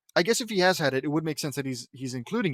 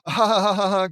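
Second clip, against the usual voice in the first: loud, higher mock guffaw; acted laugh; 4 semitones higher, 7 dB louder than usual. Reader's character reacting to Luann's pun, loud put-on laugh.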